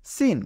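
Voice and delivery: সিন said high as a clear letter name; letter name sin, high. Shown as a demonstration. Teacher announcing the letter সিন to students, high. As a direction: sing out সিন high and bright as the answer. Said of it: "এটি হলো".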